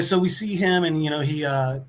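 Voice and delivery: ordinary talk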